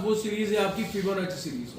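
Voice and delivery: ordinary delivery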